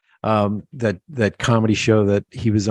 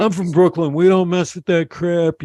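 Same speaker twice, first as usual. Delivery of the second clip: Brooklyn tough-guy; 5 semitones higher than usual. Retold or imitated. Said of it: "Colin Quinn will come out and go".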